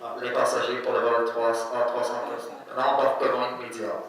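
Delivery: gate announcer calling boarding; airport announcement. Retold or imitated. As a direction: call the flight like a PA. Imitating a boarding call. (annonce d'embarquement à l'aéroport)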